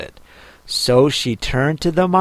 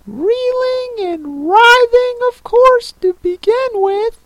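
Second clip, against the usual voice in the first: very high, wailing turtle voice; plaintive Mock Turtle; 5 dB louder than usual. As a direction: recount it in a squeaky, weepy warble.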